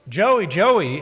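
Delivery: loud calling out after someone; calling after a man riding off on his bike; yelling a name. Retold or imitated. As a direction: shout the name across the parking lot. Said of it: "and I yelled at him".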